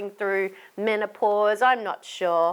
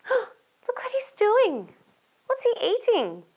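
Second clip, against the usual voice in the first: shocked gasp; 11 semitones higher than usual. Pretend. Storyteller showing children what Bertie is up to, shocked.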